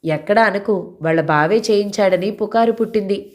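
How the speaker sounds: ordinary delivery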